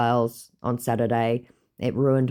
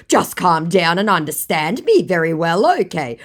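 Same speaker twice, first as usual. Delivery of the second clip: higher, louder hammed-up sweet talk; mock scammer voice; 8 semitones higher, 6 dB louder than usual. Imitating the scammer selling himself to her, hammed-up and higher. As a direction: ham up the scammer's smooth self-praise. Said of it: "said my scammer".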